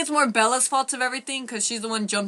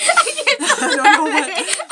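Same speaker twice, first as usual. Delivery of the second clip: laughing; 13 semitones higher, 9 dB louder, much slower than usual. Real, not acted. Laughing at her own tears.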